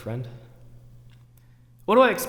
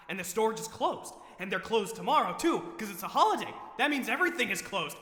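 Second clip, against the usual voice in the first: high-pitched, softer fretting; distraught panic; 6 semitones higher, 5 dB quieter than usual. Acted. Shopper who forgot cold cuts as stores close, distraught panic. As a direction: fret in a high, despairing voice.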